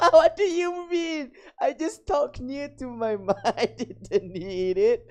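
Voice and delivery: high, loud words through real laughter; laughing protest. Real, not acted. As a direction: protest helplessly while cracking up. Laughs at own mic mishap.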